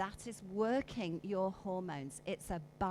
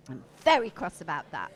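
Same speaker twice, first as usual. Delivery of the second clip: cross; 2 semitones lower, 12 dB louder, faster than usual. Shown as a demonstration. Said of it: "Go on, do it".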